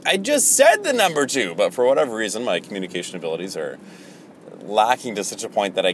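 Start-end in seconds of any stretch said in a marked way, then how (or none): 0.1-1.5 high-pitched incredulous protest; exasperated, pitch lifted well up; mock exasperation. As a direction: protest it high, as if unfairly ignored. Acts exasperated reply to the crowd.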